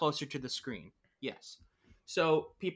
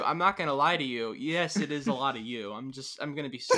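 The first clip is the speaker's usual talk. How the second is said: ordinary delivery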